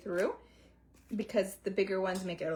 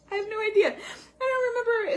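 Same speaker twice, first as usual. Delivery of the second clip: giggling through the words, high; laughing; 13 semitones higher, 6 dB louder, faster than usual. Real, not acted. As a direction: laugh it off, embarrassed. Forgetting what bran is.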